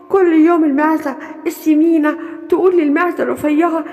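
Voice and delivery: usual speaking voice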